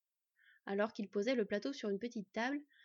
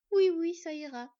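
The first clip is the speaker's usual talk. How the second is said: slow and louder through sniffles, a touch of tears; sniffling, slow; 6 semitones higher, 9 dB louder, slower than usual. A narrator's reading. The girl answers the fox while sniffling, slow teary voice. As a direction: hold back tears, sniffing between the words. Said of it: "répondit-elle en reniflant".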